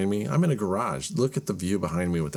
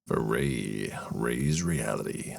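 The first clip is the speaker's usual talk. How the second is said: deep, slow crooning bass voice; low and smooth like a soul crooner; 9 semitones lower, 4 dB quieter, slower than usual. Retold or imitated.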